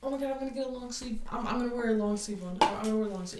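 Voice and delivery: fast, flustered rambling; mock-frantic friend; rushed panicky quote. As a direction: rattle it off like she's panicking. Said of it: "so she was like".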